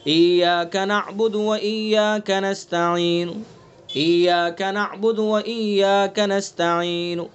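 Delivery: slow tajweed ایاک نعبد و ایاک نستعین with a faint final pesh; Quranic recitation. Shown as a demonstration. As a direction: recite slowly, trailing the last vowel. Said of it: "پیش میں ہوگا".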